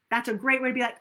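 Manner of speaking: normal talking voice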